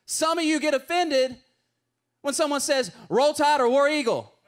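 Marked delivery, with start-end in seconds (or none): 3.1-4.3 louder mock football chant; rival cheer. Preacher imitates college football fans with a louder mock cheer. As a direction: bellow it like a fan in the stands.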